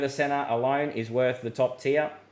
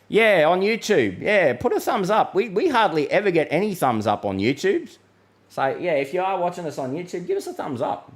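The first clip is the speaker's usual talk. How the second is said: higher, playful begging for thumbs up; coaxing plea; 5 semitones higher than usual. Real, not acted. Forecaster begging YouTube viewers for thumbs up, higher and coaxing. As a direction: wheedle the audience for likes.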